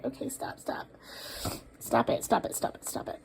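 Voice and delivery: hushed whisper telling herself to stop; under-breath stop it; whispered self-calming. Real, not acted. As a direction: whisper yourself back to composure. Calming herself, fighting tears.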